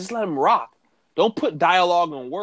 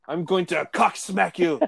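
Drew McIntyre impression; 3 semitones higher than usual. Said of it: "That's literally how he talked".

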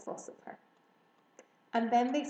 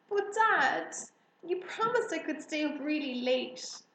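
put-on high, loud child's protest at bedtime; pleading protest; 6 semitones higher, 4 dB louder than usual. A narrator's reading. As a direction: beg Dad, you promised. Child protests being sent to bed.

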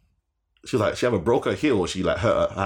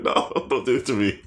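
mock-panicked plea, raised pitch; higher, half-laughing panic; panicking; 5 semitones higher than usual. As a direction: plead in retold panic. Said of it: "I'm, I'm panicking".

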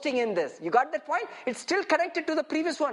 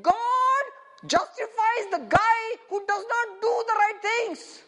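incredulous; 8 semitones higher than usual. Acted. Acts out incredulity at the verse.

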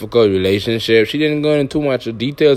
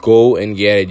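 ordinary talk, nothing marked

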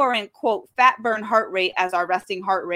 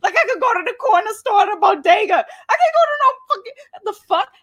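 frantic rant; high exasperated shout; 14 semitones higher, 8 dB louder than usual. Retold or imitated. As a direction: yell high in disbelief. Quoting his own exasperation.

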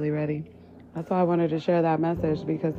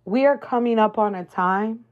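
ordinary delivery

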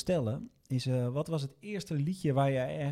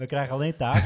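laughing; 6 dB louder, faster than usual. Real, not acted. Co-host echoes, laughing.

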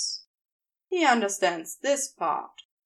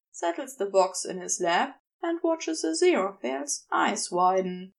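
ordinary delivery, nothing marked

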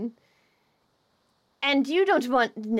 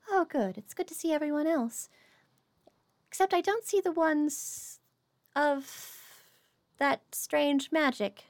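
higher, slow, measured queenly voice; serene fairy queen; 4 semitones higher, slower than usual. Acted. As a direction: take your time, like a gracious queen.

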